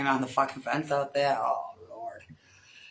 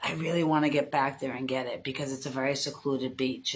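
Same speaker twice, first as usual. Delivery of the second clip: ordinary talk, nothing marked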